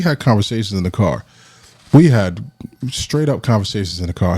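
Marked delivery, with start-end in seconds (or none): none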